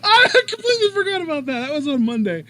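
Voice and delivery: high, loud, helpless laughter through the words; real laughing. Real, not acted. Co-host reacting to the forgotten nickname, cracking up laughing. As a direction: squeal it out while cracking up, easing off. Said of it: "I'm just laughing".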